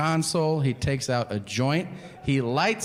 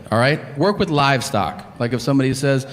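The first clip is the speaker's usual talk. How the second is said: ordinary talk, nothing marked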